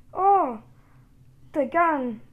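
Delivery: loud, high exclamation of delight; excited discovery. Real, not acted. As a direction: burst out at the treasure found. Opens chest, finds weapon.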